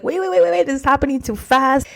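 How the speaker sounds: high-pitched, rapid-fire waits easing slightly; panikiges Bremsen. Retold or imitated. Retold alarm at fast change.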